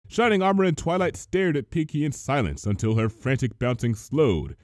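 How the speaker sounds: plain reading voice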